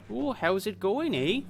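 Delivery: slow, high-pitched mock Canadian-Minnesota accent. Streamer showing how Canadian and Minnesota accents blur, hammed-up Canadian accent. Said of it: "The, the stereotyped Canadian accent".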